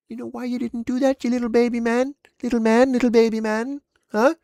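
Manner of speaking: high, loud mock baby-taunting; escalating high-pitched bullying taunt; belittling taunt. Taunts man in the clip.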